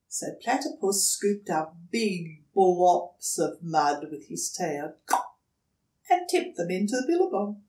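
ordinary delivery, nothing marked

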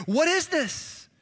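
high, slow, stretched-out amazement; astonished crowd. Retold or imitated. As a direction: gasp it out in wonder. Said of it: "the crowd is even more amazed".

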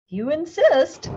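high, loud, slow reply; calm threat from a wasp; yellow jacket voice. A narrator's reading. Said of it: "said the yellow jacket".

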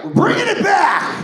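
very high, loud mock cheer; mock excited shout. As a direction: yell it like a proud local. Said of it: "you guys were like".

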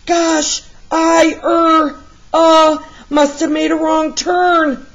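high, hesitant stammering excuse; a cornered hero stammering to the crooks; nervous stammer. Acted. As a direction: fumble for an excuse, caught red-handed. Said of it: "said Art Dog".

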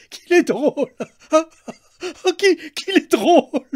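very high, loud, hysterical laughter; laughing like a madwoman, crying out; mad laughing cry. A narrator's reading. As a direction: shriek it through crazed laughter. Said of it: "rire comme une folle, criant".

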